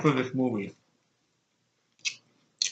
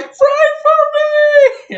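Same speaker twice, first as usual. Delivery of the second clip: hammed-up high, drawn-out scream; imitated shriek; 9 dB louder, much slower than usual. Blind nun thrown down the street, mock shriek. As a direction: wail it loud and very high, stretching the words.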